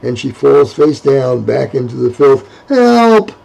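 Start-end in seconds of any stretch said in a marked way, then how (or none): 2.7-3.3 high loud scream for help. Acted. Falling back in the filth, Abigail screams for help. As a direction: scream it out desperately. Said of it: "she screams again".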